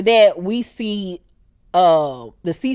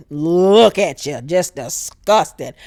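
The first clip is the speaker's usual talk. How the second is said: slow, lower sneering take; mock disdain; 4 semitones lower, slower than usual. Rhaenyra sneering at Alicent, slow and low. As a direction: drag it out with contempt. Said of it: "So Rhaenyra was like".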